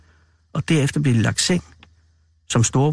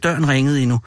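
ordinary delivery

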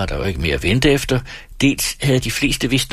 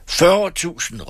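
slow, much higher bid, halting with rage; stammering with anger; 10 semitones higher, much slower than usual. A narrator's reading. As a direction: force the words out through anger. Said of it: "med en stemme, der stammede af vrede".

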